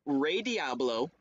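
ordinary talk, nothing marked

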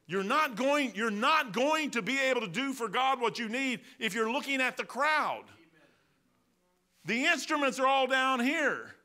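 loud, high preaching at full force to the congregation. Acted. Preacher to congregation about the crowd, shouting at full force. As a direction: drive it home, loud and high.

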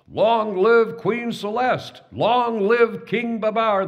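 high, loud, slow cheering as one; crowd shout. Acted. Elephants hailing their new king and queen, loud high slow cheer. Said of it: "the elephants shouted with one voice".

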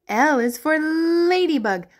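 usual speaking voice

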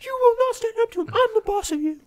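high, loud, quick mock yell; imitated scream. Boss asserting authority over staff, imitated screaming. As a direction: pull rank in a screamed imitation. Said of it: "Lala was screaming at me".

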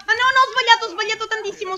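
high-pitched flustered admission; frantic, voice shooting up; panicked dismay. Real, not acted. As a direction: blurt the mistake out in alarm. Player makes a bad mistake.

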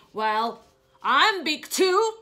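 loud, high, slow boast; proud boast. Acted. Gerald boasting to Piggy that he is big too. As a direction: puff up and boast it.

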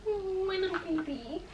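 high, soft cooing to a pet. Real, not acted. Cooing at the dog Bentley.

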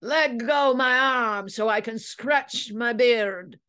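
high, drawn-out whaler's screech; shrieked. A narrator's reading. Itching prisoner begs deputy to free his arm, shrieking. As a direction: shriek it slowly, high-voiced. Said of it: "Ellsworth shrieked".

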